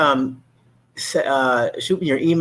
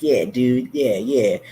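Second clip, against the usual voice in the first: laid-back whispered "yeah, dude"; breathy, chill muso brush-off; cool musician voice; much slower than usual. Retold or imitated.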